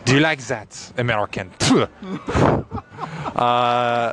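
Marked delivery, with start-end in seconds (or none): 0.0-1.9 put-on taunting French accent, spat-out finish; French accent with spit. Mock Frenchman taunting an American, French accent with a spit. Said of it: "Always a little spit at the end".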